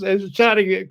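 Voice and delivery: ordinary talk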